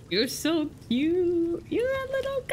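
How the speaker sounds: slow gushing coo climbing very high; drawn-out, high-pitched fawning. Real, not acted. Gushing over a cute creature.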